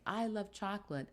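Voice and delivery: high-pitched double exclamation; intense statement. Shown as a demonstration. Teacher showing double exclamation marks, intense exclamation. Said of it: "I am making a very intense statement".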